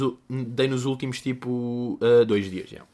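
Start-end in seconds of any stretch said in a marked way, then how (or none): none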